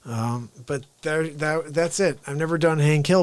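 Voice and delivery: ordinary talk, nothing marked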